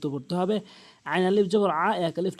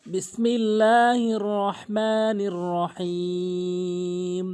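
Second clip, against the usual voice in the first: slow, drawn-out tajweed recitation; Quranic recitation; much slower than usual. Shown as a demonstration. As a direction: recite slowly, holding each long vowel. Opens lesson with recited invocation.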